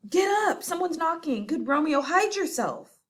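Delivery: urgent. Acted. Friar urging hidden Romeo.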